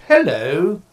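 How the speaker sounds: loud, jokey imitation of a posh English accent; mock posh English. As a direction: mimic a posh Brit greeting loudly. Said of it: "I didn't sense any English posh".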